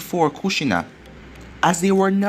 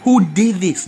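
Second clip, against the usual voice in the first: screamed out; 3 semitones higher, 5 dB louder, much slower than usual. Acted. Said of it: "as he screamed out".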